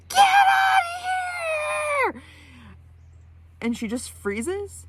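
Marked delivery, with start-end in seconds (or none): 0.1-2.2 high-pitched terrified scream. Retold or imitated. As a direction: shriek it at full volume to drive it off. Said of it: "I just scream in absolute terror out of instinct, really, really high-pitched".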